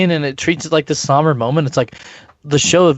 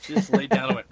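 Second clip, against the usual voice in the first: ordinary talk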